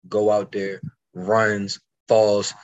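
ordinary talk

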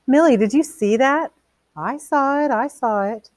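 high, eager squeal of excitement; thrilled guest. Acted. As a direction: gasp along with the violent show. Women excited at TV crash.

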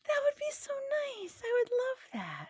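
very high, gushing squeal; touched delight. Real, not acted. Guest offers to come say hello, touched high gush.

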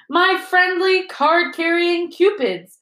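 high, slow, beaming delight. A narrator's reading. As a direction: beam it out proudly. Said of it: "beamed Lockhart".

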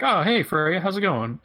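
lilting mock-friendly hello; Mimir. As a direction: voice a casual hello, pitched well up. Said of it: "and he's like".

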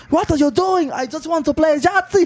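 high, hammed-up caricature voice; mock Hitler. Acting out Hitler entering the room, mock Hitler. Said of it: "Hitler comes in the room".

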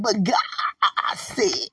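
"God" hugely drawn out; emphasis on God. Retold or imitated. Retelling own prophecy to cousin, emphatic drawn-out "God". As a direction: stretch "God" very long, like a preacher's flourish. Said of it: "I had to emphasize God".

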